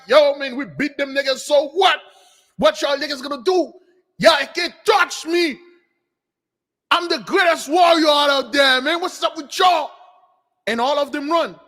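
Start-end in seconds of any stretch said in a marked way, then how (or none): 0.1-9.9 loud, higher-pitched swagger; proud boasting. Retold or imitated. Zippo celebrating victory before everyone, proud loud boasting. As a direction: crow it like a puffed-up warrior, challenging all comers. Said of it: "very proud, walk around here with his chest poke out".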